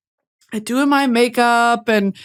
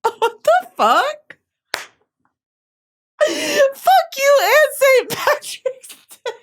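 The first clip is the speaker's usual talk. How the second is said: much higher, cry-laughing squeal; imitating the girl's outburst; 14 semitones higher than usual. The girl in the video yelling at her friends, squealed. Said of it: "she said".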